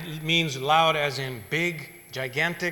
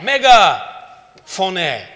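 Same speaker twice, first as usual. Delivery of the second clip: "メガフォネ" called out much louder, like through a megaphone; loud megaphone word; 11 dB louder than usual. Shown as a demonstration. Preacher explaining Greek to the congregation, calling "メガフォネ" out loud. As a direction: boom out "メガフォネ". Explaining mega plus phone.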